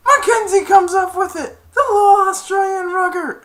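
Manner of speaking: very high, loud play-by-play shout; excited commentator imitation. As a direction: yell the call over a roaring game. Retold game commentary.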